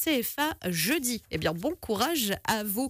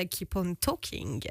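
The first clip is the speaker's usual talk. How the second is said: English song title; 4 semitones lower, 3 dB quieter, slower than usual. Acted. Radio host announcing the track, slow English.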